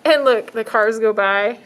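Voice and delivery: ordinary delivery, nothing marked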